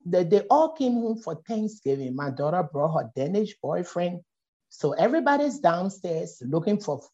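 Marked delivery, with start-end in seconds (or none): none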